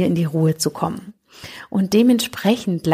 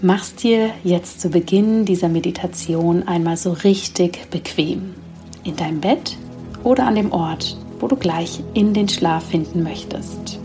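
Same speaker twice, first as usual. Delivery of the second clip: sleep meditation. Acted. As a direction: guide them gently into sleep.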